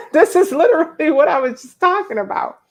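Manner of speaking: bursting into high breathy laughter, then talking through it; laughing. Real, not acted. Host laughing at a viewer's comment, high and loud. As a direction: crack up at the comment and keep laughing into the words. [name] caught out, host cracks up.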